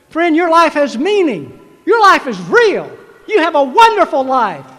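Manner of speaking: full-voiced sermon climax; preaching at full force. Real, not acted. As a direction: proclaim it to the graduates.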